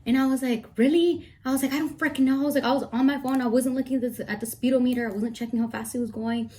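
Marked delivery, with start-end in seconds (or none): 0.8-1.3 high, loud incredulous snap; indignant, disbelieving; retold outburst. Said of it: "I went off on the firefighter". 1.7-6.5 higher, louder exasperated venting; reliving the irritation, hammed-up; retold rant. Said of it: "I went off on the firefighter".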